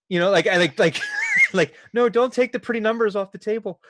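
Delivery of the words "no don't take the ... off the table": high mock plea; mock whine. GM voicing Tomasi, begging Lord Kai, in a high mock whine. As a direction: whine like a child losing a toy. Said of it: "going like".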